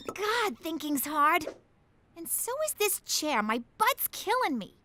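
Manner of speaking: normal talking voice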